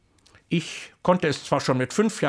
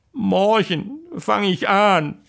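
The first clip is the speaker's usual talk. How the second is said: slow, drawn-out deadpan; punchline; 6 semitones higher, 4 dB louder, slower than usual. Acted. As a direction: land the joke slowly. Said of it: "ruft der Henner".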